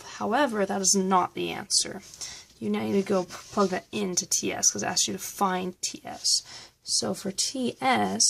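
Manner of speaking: ordinary talk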